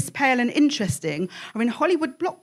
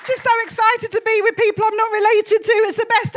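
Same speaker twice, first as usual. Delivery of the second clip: excited; 10 semitones higher than usual. Real, not acted. Comedian bursting onto stage excitedly.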